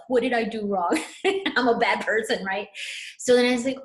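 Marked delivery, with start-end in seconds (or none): 0.1-2.4 laughing through a mock self-accusation; mock self-blame. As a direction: tease your own guilt with a laugh. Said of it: "I immediately blamed myself".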